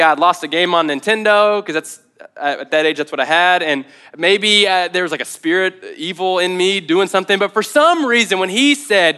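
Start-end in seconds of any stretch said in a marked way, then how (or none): none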